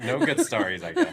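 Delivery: laughing through the words; high, loud laughing. Real, not acted. Laughing after dog story.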